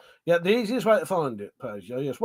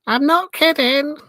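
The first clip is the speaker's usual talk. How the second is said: silly high voice, pitched way up; Leatherface; 11 semitones higher, 6 dB louder than usual. Acted. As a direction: put on a high, loud cartoonish voice. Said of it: "is Leatherface going".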